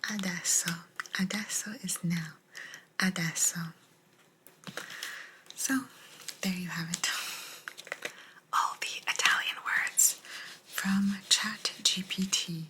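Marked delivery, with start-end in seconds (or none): none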